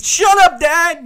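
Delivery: put-on high, loud kid's outburst at dad; explosive mock yell of an exasperated son; imitated yell. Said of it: "yelling at me".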